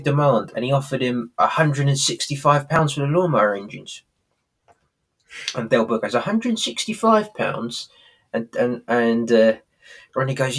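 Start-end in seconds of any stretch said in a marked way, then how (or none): 6.1-7.9 high, slow disbelieving repeat; incredulous. Retold or imitated. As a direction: Del Boy can't believe the price, high and slow. Del Boy incredulous at price.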